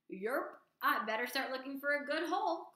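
second frog, casual and chatty; croaking frog. Acted. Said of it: "croaked the second frog".

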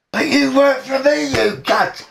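high-pitched indignant protest; indignant retelling. Retold or imitated. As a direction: act out an aggrieved telling-off. Acted quarrel over who he works for.

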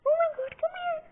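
very high-pitched call to a kitten; excited calling. Real, not acted. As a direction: squeal it like you're calling a kitten over. Calling her kitten over.

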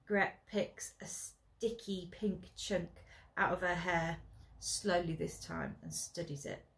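ordinary delivery, nothing marked

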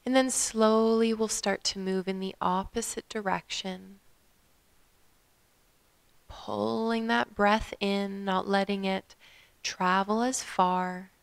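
calm meditation guide with long pauses; guided meditation. Acted. Yoga teacher guiding listeners to follow their breath, guided meditation. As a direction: lead them gently through the breath, leaving space.

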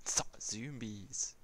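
slow, drawn-out whisper; teasing slow whisper. Acted. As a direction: hush it out slowly. Mock whisper about zombies.

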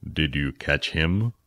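a curious elder leaning in; Phil, interested friend. Acted. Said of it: "to interested friend".

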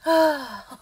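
loud, triumphant evil laugh. Acted. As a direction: cackle in delight at the game. Villain gloats loudly.

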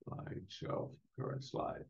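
whispered to herself under breath; muttered whisper. Real, not acted. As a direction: mutter the menu names aside, voiceless. Muttering menu names to self.

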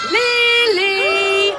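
loud, bright call of a name. Acted. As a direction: call her onto the stage. Presenter calls out the character.

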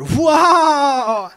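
big high shout of wonder; high, loud amazed whoa; shouted surfer whoa. Shown as a demonstration. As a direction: belt out the whoa like a stoked surfer. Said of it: "I sound like a surfer".

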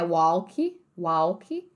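"walk" misread as "wow-kee"; wrong sounded L. Shown as a demonstration. Teacher showing what to avoid, "walk" as "wow-kee". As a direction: say "walk" as spelt, with a w-glide and final ee. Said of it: "esse A L não é".

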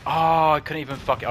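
sudden loud, high cry of frustration; frustrated outburst. Real, not acted. Gamer staggered mid-fight, loud frustrated outburst. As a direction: blurt it out, annoyed at the game.

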